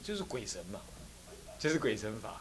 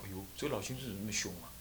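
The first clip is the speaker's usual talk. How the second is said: quick muttered aside; 6 semitones lower, 15 dB quieter, much faster than usual. Acted. Visitor mutters to himself.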